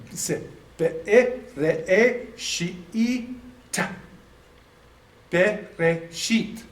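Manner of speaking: be, re, shi, ta sounded out one by one; syllable drill. Shown as a demonstration. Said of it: "notice what you've got now".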